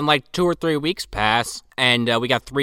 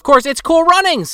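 high, loud joking exclamation; mock excitement; 6 dB louder than usual. Announcing the go-kart's name, mock-excited shout. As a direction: build to a gleeful mock shout. Crowing the go-kart joke.

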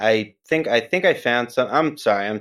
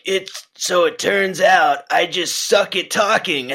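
imitating Steve-O; 9 semitones higher, 3 dB louder than usual. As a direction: mimic Steve-O's wrecked voice. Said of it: "the way that he said it in the video was like".

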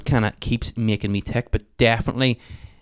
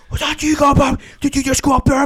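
imitating angry neighbour; 6 dB louder than usual. Angry neighbour confronts him.

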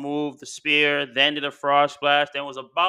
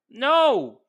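sharp loud no, pitched up; emphatic no; 7 semitones higher, 6 dB louder than usual. Retold or imitated. Jimmy insisting to his brother, loud sharp no. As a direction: cut him off hard. Jimmy retelling fight to Jey.

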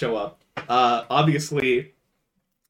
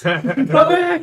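much higher, louder mock cry of pain; hammed-up yell; 7 dB louder than usual. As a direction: overact getting hurt and call for the police. Fake injury crying for police.

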